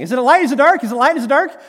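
loud, high, panicked back-and-forth questions; frantic shouting. Acted. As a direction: yell it while chasing something. Crew frantically chasing the dice.

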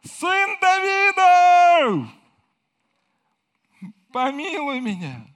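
long, high, loud drawn-out cry. Acted. Blind man calls out to Jesus, a high loud pleading cry. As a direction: call across the crowd with all you've got. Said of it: "он воскликнул".

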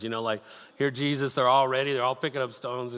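ordinary talk, nothing marked

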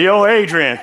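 loud Philly tough-guy call; hammed-up street-fighter voice; Rocky. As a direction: imitate a boxer calling out. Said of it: "like Rocky".

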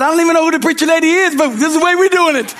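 high, fast, laughing comeback; high-pitched laughing mock defiance; laughing retort. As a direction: toss it back with a laugh. Pastor retorts to church members.

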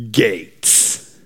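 low, hushed single word; savored word. Real, not acted. Preacher reveals his favorite word in the verse, low. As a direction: say it low and drop it.

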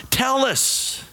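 high, insistent demand. A narrator's reading. Third cave dweller to the unresponsive stranger, demanding. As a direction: demand an answer, pitched right up. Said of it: "demanded a third".

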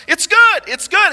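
high, loud cheery optimist; acted irrepressible cheer; super optimistic. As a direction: insist on it brightly, pitch way up. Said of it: "just super optimistic".

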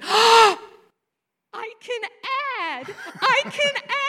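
high, bursting "I can add"; thrilled discovery. Retold or imitated. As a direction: cry out a child's sudden triumph. Boy suddenly understands addition.

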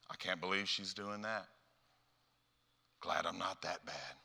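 low, soft judgmental aside; quiet self-satisfied muttering; hushed gossip. Acted. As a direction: murmur it privately, looking down on someone. Gossiping about Susan.

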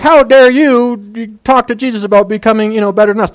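indignant outburst, put on for listeners; mock indignation. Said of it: "they were upset, right?".

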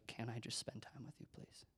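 very soft plea, fading into a whisper; hushed, tender imitation of a plea; gentle pleading. God's soft plea acted.

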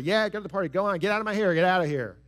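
impatient, harsh send-off; harsh father. Acted. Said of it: "One has been harsh".

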